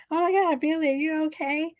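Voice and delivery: high alarmed gasp; mock alarm. Sidney mock-panicking over wounded Billy. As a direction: squeal it with fake worry.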